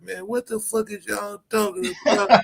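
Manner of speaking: a laughing jab at the friend's gripe; imitating Savio. Mimicking Savio crashing the panel, mock grumble. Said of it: "That motherfucker Savio said".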